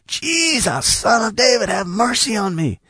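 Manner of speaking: very high, strained pleading call. Retold or imitated. Blind man calling after being hushed, desperate cry. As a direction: stretch the name out in a high, thin cry. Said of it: "the blind man cried out louder".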